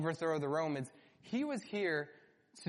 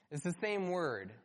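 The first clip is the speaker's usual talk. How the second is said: normal talking voice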